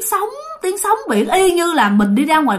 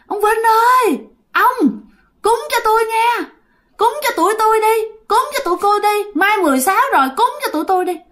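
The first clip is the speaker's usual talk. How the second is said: high, eerie voice begging for offerings; a ghost whispering into his ear, pitched high and pleading; ghost's pleading voice; 9 semitones higher than usual. Retold or imitated. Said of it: "nghe rất là rõ giọng đàn ông". (giọng ma cao, van nài xin cúng)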